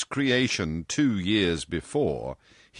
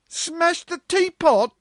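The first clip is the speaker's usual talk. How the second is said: put-on much higher and louder, slow, aghast girls; shocked cry; 8 dB louder, slower than usual. Office girls hearing the teapot was smashed, aghast high cry. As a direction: gasp it out in disbelief. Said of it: "cried several girls in chorus".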